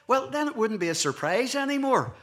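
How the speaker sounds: high, loud parental comeback; exasperated parent. Retold or imitated. Parent explaining to child why the surprise waits, exasperated.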